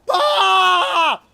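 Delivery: very high, loud drawn-out "bah" shriek; playful scream. Retold or imitated. Dad imitating his wife yelling at the kid, playful scream. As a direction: imitate a playful yell at full volume. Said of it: "I'll hear my wife try to go".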